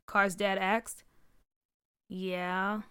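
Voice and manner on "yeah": a soft, subdued yeah; quiet. A narrator's reading. Son quietly admitting to dad, subdued. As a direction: answer quietly, holding back. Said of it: "Car responded quietly".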